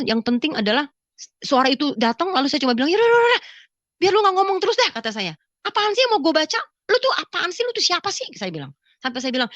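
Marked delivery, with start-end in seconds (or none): 2.9-4.9 high, loud fed-up outburst; retold exasperation. Woman telling the voice to quit nagging, exasperated. 5.7-8.2 high-pitched, impatient questioning; retold irritated challenge. Woman challenging the unseen voice, irritated and high.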